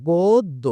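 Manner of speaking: গোদ্দ said as "godd-o", the দ doubled and held; teacher models গোদ্দ with a doubled দ; pronunciation demo. Shown as a demonstration. Teacher to students, modelling the doubled দ in গোদ্দ. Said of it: "এটার উচ্চারণটা হচ্ছে".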